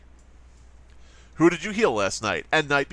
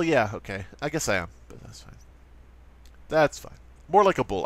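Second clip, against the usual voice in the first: ordinary delivery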